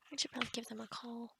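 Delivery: quick, soft note to self; mumbled aside. Pretend. Healer distracted by an old cure, mumbling to herself. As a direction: mutter it fast under your breath. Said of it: "ignore me if I'm, like, mumbling".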